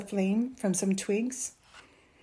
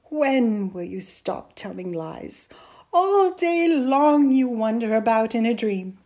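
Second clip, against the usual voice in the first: weary scolding that builds louder; 3 semitones higher, 10 dB louder than usual. A narrator's reading. Mother tired of her son's lies, weary. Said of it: "she said wearily".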